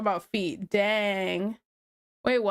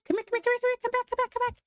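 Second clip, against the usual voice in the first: high sing-song call, climbing much higher; 12 semitones higher, much faster than usual. Real, not acted. Mother calling her daughter back into the room, high coaxing.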